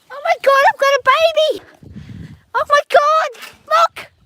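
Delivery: high, loud excited cry; can't believe the new babies; overjoyed shout of discovery; excited squeal. Real, not acted. Discovers newborn baby animals.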